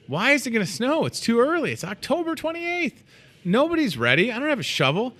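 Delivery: high, pleading whine about the snow; whiny complaint. Real, not acted. Host whining to listeners about early snow, high and exasperated. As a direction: complain like nobody's ready for this.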